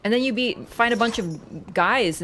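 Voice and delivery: ordinary talk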